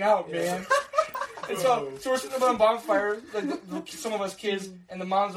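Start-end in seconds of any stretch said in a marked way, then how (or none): none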